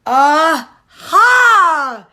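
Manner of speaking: loud triumphant cry; triumphant aha. A narrator's reading. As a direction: shout it in discovery. Birds realize the truth.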